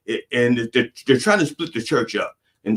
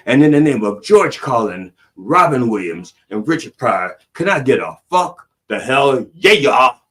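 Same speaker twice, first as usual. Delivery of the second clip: louder rousing preacher call; 5 dB louder than usual. Acted. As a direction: whip up the congregation.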